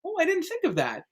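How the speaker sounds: mock pleasant surprise; pleasantly surprised. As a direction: imitate a surprised director. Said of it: "they'll hear something completely different and be like".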